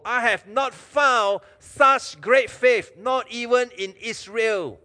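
loud, high, drawn-out proclamation; marveling declaration. Acted. Jesus tells those who follow of great faith, marveling.

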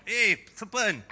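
high, loud mock hail to a snake; playful calling out. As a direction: call out as if spotting a serpent. Playfully calls to a serpent.

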